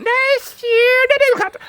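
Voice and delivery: high, loud "You can't"; little pig taunting; high defiant pig. Acted. Pig arguing.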